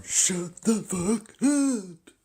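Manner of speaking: high, slow, shrieking yell; drawn-out high furious shout; mock shouted insult. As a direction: screech it out like the angry clerk. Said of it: "He's going".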